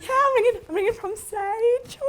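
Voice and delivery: put-on high, sweet young caller voice; chirpy nervous cold caller; young telesales girl. Imitating her young self on the phone, high and sweet. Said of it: "I used to go".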